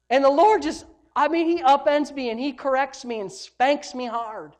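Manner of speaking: loud, high sermon emphasis; emphatic preaching. Real, not acted. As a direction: preach it forcefully, drawing out the end. Preaches at full force.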